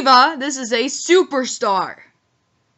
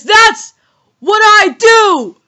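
booming announcer hype, drawn out; ring announcer voice; 9 semitones higher, 13 dB louder, slower than usual. Shown as a demonstration. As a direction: belt it like a boxing ring announcer. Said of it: "Let me say it again".

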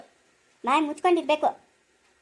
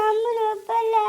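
high, crying protest; tearful objection; sobbing; 7 semitones higher, slower than usual. Acted. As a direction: sob it out. Daughter crying, mother consoling.

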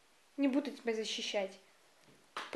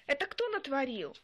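ordinary talk

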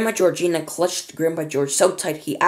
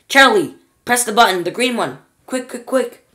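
urgent; 7 semitones higher, 6 dB louder than usual. Acted. Urgent order to press button.

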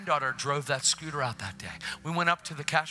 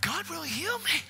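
high-pitched, amazed joy, acted out; excited grandma; 10 semitones higher than usual. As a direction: voice a grandma thrilled at her healing, rising higher. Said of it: "Now she's excited".

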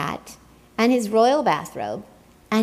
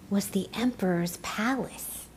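hushed whisper, as if sharing a secret; whispered reveal; 8 dB quieter than usual. A narrator's reading. Storyteller telling listeners a secret, whispered. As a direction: breathe the reveal softly.

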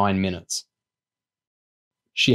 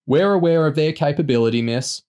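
raised, condescending reply; higher-pitched call with a dismissive drop on "miss"; patronizing shout; 6 semitones higher than usual. A narrator's reading. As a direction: talk down to her over helicopter noise. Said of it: "shouted back patronizingly".

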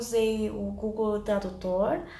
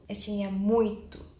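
usual speaking voice

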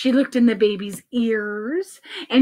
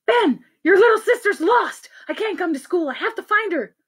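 higher, agitated phone voice; frantic mother; 8 semitones higher than usual. Acted. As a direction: fret like a panicked mom.

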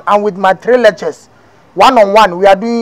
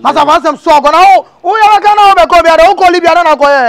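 heated outburst; 8 semitones higher, 4 dB louder than usual. Acted. Heated argument between friends.

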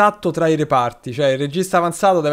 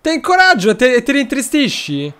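high, louder exasperated complaint; incredulous, pitched-up reproach; exasperated disbelief; 8 semitones higher, 5 dB louder than usual. Real, not acted. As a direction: throw up your hands at the sulking player. Player sulks despite encouragement.

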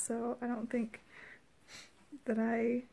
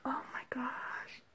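slow breathless whisper; barely breathing it out; stunned whisper; 6 dB quieter, slower than usual. Real, not acted.

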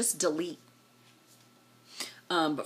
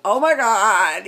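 drawn out and much louder; loud exclaimed oh my God; 4 semitones higher, 11 dB louder, slower than usual. Retold or imitated. Telling the group-chat story, a loud slow shocked exclamation. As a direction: blurt it out slow and loud in disbelief. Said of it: "I, I was like".

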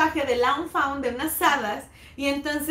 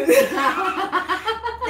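louder, higher burst of laughter; real laugh; 5 semitones higher, 4 dB louder than usual. Real, not acted. Finishing the guillotine story to her friend, laughing out. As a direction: laugh out at the memory. Laughing after thanks quote.

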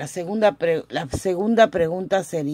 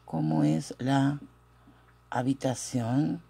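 feed it to learners in pieces; dictation pace; 3 semitones lower, 4 dB quieter than usual. Shown as a demonstration. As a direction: split by a long pause, softer and lower at the end. Teacher dictates question slowly. (dictado pausado, en dos partes)

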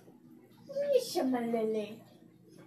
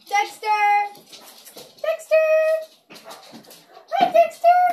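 building higher and louder calls; excited calling; 13 semitones higher, 9 dB louder than usual. Real, not acted. Owner calls out to her dog with rising excitement. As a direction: squeal the dog's name louder each time.

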